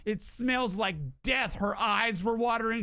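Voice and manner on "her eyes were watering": usual speaking voice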